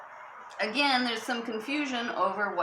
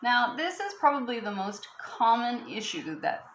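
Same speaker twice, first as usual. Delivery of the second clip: ordinary talk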